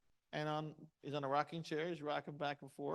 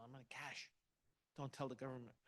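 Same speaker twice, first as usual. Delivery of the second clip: much softer, lower, joking secret; confidential aside; 4 semitones lower, 12 dB quieter than usual. Retold or imitated. Salesman confides to audience about cash pay, hushed joking aside. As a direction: drop it low like a sly confession.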